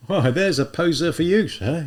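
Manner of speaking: put-on an older policeman clucking over the girl; policeman character.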